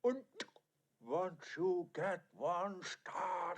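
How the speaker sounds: ordinary talk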